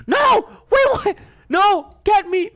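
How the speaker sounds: high, loud yelps of panic; panicked shriek. Real, not acted. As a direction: shriek for help as things go wrong. Panics as enemies attack.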